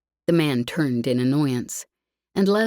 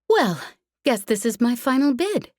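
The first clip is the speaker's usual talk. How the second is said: bright, louder and higher, cutting through the room; cheery and loud; 8 semitones higher than usual. Acted. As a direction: announce it cheerily so the whole club hears. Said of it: "she said cheerily, loudly enough".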